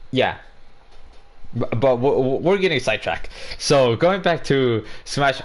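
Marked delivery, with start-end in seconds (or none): none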